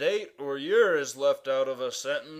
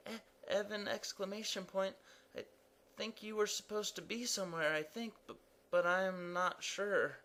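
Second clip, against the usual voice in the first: soft, higher, hurried stammer; flustered Evan; 4 semitones higher, 9 dB quieter, faster than usual. Acted. Evan answers the impatient king, flustered and unsure. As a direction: fumble for an answer.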